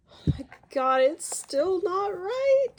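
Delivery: frustrated cry of disbelief, drawn out; exasperated outburst. Real, not acted. Fourth attempt fails again.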